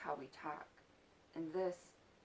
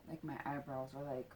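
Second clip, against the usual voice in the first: usual speaking voice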